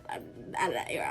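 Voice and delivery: a hushed confession to the camera; whispered aside. Real, not acted. Vlogger confiding to viewers, whispered. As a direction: breathe I'm out of that era as a whisper. Whispered repeat of her line.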